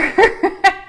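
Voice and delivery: a loud high burst of laughter; high bright laugh. Real, not acted. Laughing at angry emojis.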